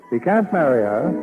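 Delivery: ordinary talk, nothing marked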